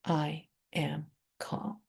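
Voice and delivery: slow whisper; whispered affirmation. Real, not acted. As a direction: breathe "I am calm" out slowly.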